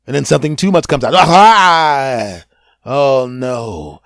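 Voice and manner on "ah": pained cry. Acted. Stomach cramps acted out.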